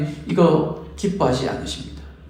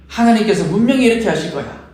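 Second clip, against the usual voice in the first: higher, firm declaring tone; bold proclamation; 4 semitones higher than usual. Retold or imitated. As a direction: proclaim it with confidence. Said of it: "담대하게 선포하는 거죠". (담대하게 선포하듯)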